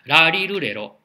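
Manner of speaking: ラリルレロ said clearly as a model; tongue slightly forward ra-row. Shown as a demonstration. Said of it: "言ってみましょうか".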